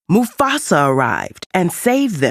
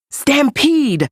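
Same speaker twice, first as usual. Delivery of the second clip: much higher, urgent cry of alarm; 10 semitones higher, slower than usual. A narrator's reading. Scar warning Mufasa of the stampede, urgently alarmed. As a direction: sound the alarm in a panic.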